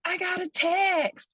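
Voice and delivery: squealing high, slow delight; Love Island excitement. Retold or imitated. Speaker mimics a thrilled Love Island contestant getting a text, squealing high. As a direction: gush over a text like a reality star. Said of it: "see how excited they are".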